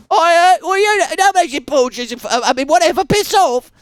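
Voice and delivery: high, loud, fast flustered bluster; flustered hooligan. Pretend. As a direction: stammer then snap.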